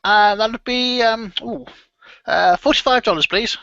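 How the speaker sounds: put-on high, hesitant old man's voice; eager to please the customer; fussy old shopkeeper. Shopkeeper names price.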